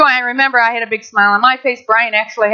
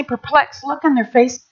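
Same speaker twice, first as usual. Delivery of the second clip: ordinary talk, nothing marked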